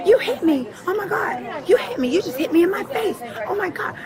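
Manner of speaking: hammed-up panicked cry of being hit; retelling a woman's screaming fit at full volume; mock hysterical yelling. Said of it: "She's like".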